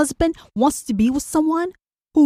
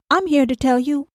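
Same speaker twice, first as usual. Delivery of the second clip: normal talking voice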